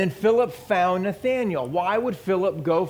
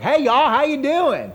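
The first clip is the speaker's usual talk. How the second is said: higher, louder, slow hammed-up Southern drawl greeting; Southern accent; 9 semitones higher, 7 dB louder than usual. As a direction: play Peter as a loud backwoods Southerner. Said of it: "it was his accent that gave him away".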